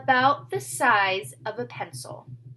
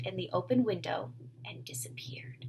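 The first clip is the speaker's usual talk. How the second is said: whispered fade-out; soft whispered ending; hushed narration; 2 semitones lower, 7 dB quieter than usual. A narrator's reading. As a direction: let it vanish into a whisper. Narration of vanishing.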